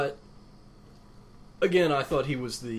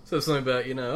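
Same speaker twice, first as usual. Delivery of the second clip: ordinary talk, nothing marked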